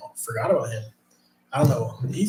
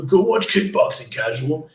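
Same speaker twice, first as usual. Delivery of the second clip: mocking commenter; 4 dB louder than usual. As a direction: sneer it like a know-it-all fan. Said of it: "I'm gonna fucking get roasted by people".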